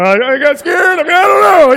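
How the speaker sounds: high, loud sheepish blurt; flustered. Retold or imitated. Bleeding hiker explaining to young couple, flustered high blurt. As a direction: stammer it out embarrassed.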